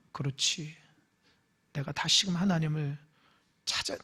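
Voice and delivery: soft, low humble answer; quiet humble acceptance; humble hush. Shown as a demonstration. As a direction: murmur it in surrender. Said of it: "겸손함으로 반응해야".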